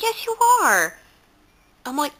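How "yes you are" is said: high, louder mock-insistent comeback; insistent retort. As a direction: imitate a friend insisting, pitched way up. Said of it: "And he's like".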